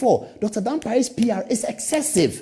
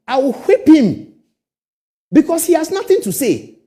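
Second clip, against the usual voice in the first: emphatic threat; 7 semitones higher, 7 dB louder than usual. Real, not acted. Threatens the Ferguson boy.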